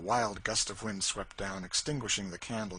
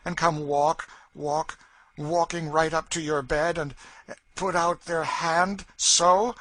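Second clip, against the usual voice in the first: higher, louder, slowing right down at the hand; Cassie's eerie ghost tale; 8 semitones higher, 10 dB louder, slower than usual. A narrator's reading. Cassie describes the ghost reaching to Legree's bed, slow and eerie. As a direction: draw out the ghost's approach to frighten him.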